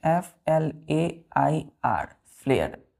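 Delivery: slow, separate letters; each letter evenly spaced for the class; spelling out. Shown as a demonstration.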